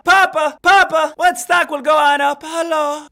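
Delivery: hammed-up lost child calling for Papa; desperate begging. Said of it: "all I do is follow people on Twitter and say".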